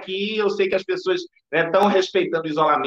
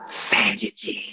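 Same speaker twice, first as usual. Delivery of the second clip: breathless ghostly whisper; creepy whisper; 5 dB quieter than usual. Acted. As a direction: hiss it like a cursed ghost. Horror ghost Samara's catchphrase.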